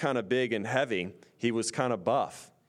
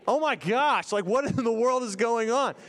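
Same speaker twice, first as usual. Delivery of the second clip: high-pitched retold panic; alarmed disbelief; 9 semitones higher than usual. Teller reliving panic at charging man.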